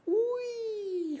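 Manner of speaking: long, high squealed "whee"; playful squeal held high. Real, not acted. Playing with a chill cat, high squealed whee.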